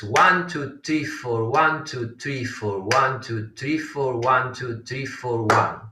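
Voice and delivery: running count in fours, no pauses; rhythmic rolling count, landing on one; chatushra gati count. Shown as a demonstration. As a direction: count the subdivisions evenly and continuously. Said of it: "In Chatushragati".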